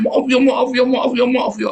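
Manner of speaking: hammed-up pleading "maaf ya"; mock groveling plea. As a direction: beg for forgiveness like a groveler. Imitating groveling begging apology.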